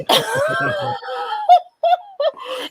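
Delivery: high, loud burst of laughter; shrieking laugh of amazement. Real, not acted. Laughing after shock.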